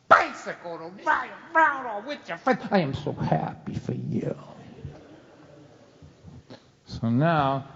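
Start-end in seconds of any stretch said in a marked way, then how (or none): 0.1-2.6 mocking dad. Dad's mocking build. 2.7-4.4 whispered mock congratulations; sarcastic whisper. Dad whispering sarcastic congratulations to his son.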